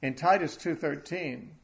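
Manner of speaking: building pulpit proclamation; preaching. Acted. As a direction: preach the hope out to the hall. Preaching with rising force.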